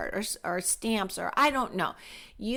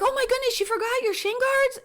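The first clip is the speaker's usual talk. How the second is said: high, loud flustered mom; mock panic; 14 semitones higher, 3 dB louder, faster than usual. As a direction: gasp it out like a frantic mother. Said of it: "I'd be like".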